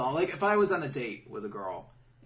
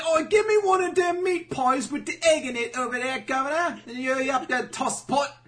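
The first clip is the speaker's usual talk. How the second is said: put-on high, loud over-the-top cockney accent; really cockney; 12 semitones higher, 8 dB louder than usual. Smooth talking a date with a loud cockney accent. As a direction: ham up a cockney geezer at the counter. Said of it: "turn into Sonic Gab and get really cockney".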